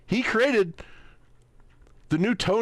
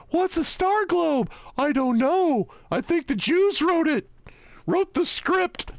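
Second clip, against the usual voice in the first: higher, faux-innocent sarcastic questions; mock naive voice; 10 semitones higher than usual. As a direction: play dumb to ridicule the theory. Mocking the conspiracy theorist's view.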